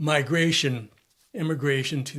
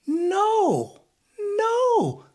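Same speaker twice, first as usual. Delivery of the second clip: mock squeal; 4 dB louder than usual. Emphatic rejection of the idea.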